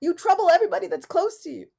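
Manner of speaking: loud, high mock yell; hammed-up outrage at Govinda; yelling bloody murder. Speaker retelling her tantrum at Govinda, loud mock yell. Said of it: "I yell, bloody murder".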